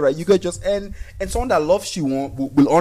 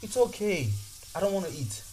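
pleading daughter; 2 semitones higher, 9 dB quieter than usual. Retold or imitated. Daughter begging father to stay home, soft imitated plea. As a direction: plead quietly as a little girl, lightly voiced.

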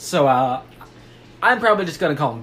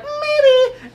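very high, louder falsetto whine, drawn out; mock-weepy Texan daughter squeak; Ruth; 5 dB louder than usual. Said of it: "she talks like that clearly".

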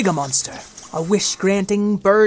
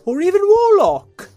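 high, louder warlock; emphatic flourish; 11 semitones higher, 7 dB louder than usual. Acted. Wizard insisting on his titles, emphatic.

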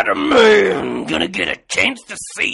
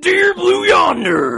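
high, slow, shouted wrestler growl; full-throated Macho Man Randy Savage shout; Macho Man impression; 12 semitones higher, 6 dB louder, slower than usual. Shown as a demonstration. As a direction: bellow it like a ring promo. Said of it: "Let's hear it".

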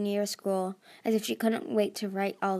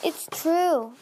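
higher, louder insistence; desperate; 7 semitones higher, 5 dB louder than usual. A narrator's reading. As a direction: plead to be believed. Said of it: "Tsunami said desperately".

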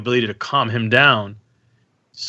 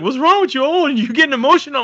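high-pitched mimicry of a partner's questions; teasing girlfriend imitation; 3 dB louder, faster than usual. Girlfriend noticing dad getting emotional, high imitated teasing. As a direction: mimic her ribbing you, pitched way up.

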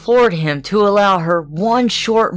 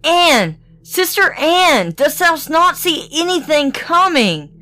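crying out; 10 semitones higher than usual. A narrator's reading. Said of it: "then she cried".

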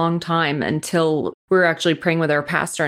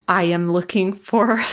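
usual speaking voice

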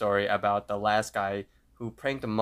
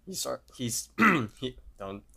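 accidental real voice crack; 4 semitones higher than usual. Real, not acted. Narrator retelling a cartoon, voice cracking. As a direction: voice cracks mid-word, then clears the throat. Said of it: "voice crack there".

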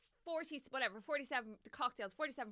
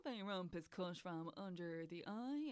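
soft, slow, lower snooty waiter voice; 5 semitones lower, 10 dB quieter than usual. Retold or imitated. Hipster waiter explaining the cut to the diner, snooty and slow. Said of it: "And he went".

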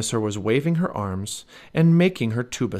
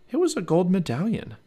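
ordinary delivery

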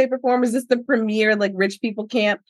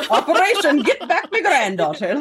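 higher-pitched mock Irish accent; 6 semitones higher than usual. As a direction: slip into a comic Irish accent through laughter. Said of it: "I don't know why that was Irish".

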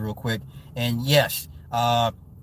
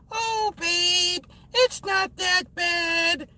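much higher, slow soothing wife voice; much slower than usual. Retold or imitated. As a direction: coo it to calm the grumpy husband. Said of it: "the wife would be like".

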